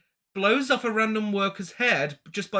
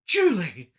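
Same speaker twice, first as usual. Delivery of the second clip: louder, surprised calling out the name; mock shocked call; 7 dB louder than usual. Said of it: "She's like".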